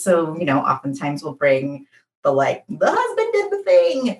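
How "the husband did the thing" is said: mock complaining wife. Mocking complaint about husband.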